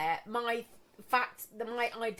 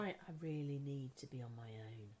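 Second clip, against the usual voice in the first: much lower and very soft, dark; low deep voice; 10 semitones lower, 17 dB quieter than usual. Shown as a demonstration. Scaring off a salesman, low and deep. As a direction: drop the voice low to scare them off. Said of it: "in a low, deep voice".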